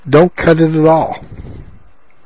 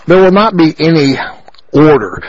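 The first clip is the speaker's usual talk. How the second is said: ordinary delivery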